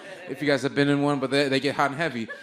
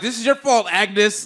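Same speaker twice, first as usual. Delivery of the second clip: mock accusing call; 5 semitones higher, 6 dB louder, slower than usual. Calling her to blame her.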